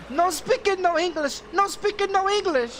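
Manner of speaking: high, loud mocking imitation; mocking high-pitched broken-English refrain; imitated call-taker. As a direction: mimic her protest in a high voice. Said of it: "then she wanna talk about".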